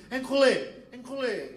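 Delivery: mock angry Frenchman; French cursing. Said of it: "Você vai ver o francês".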